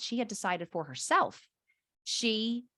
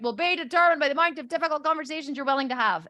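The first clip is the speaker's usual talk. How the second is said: higher-pitched mock recitation; imitated reluctant repeat of a mantra; grudging recital; 5 semitones higher, 4 dB louder, faster than usual. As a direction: chant it back like a drilled child. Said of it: "and they repeat it".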